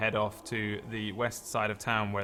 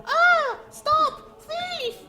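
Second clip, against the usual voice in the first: high, loud falsetto shriek; shrieking woman; 7 dB louder, much slower than usual. Acted. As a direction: screech it like a scandalised woman. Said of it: "a woman just goes".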